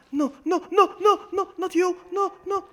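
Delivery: high-pitched frantic repeated cries; gamer's squealed alarm; panicked. Real, not acted. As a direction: yelp it in rising panic. Panicking as the game goes bad.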